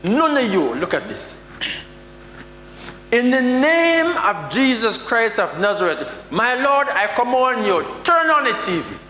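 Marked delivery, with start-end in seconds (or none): 3.1-9.0 mock pastor command. Preacher mocking pastors who command God, hammed-up booming command. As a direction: ham up a preacher ordering God to turn on the TV.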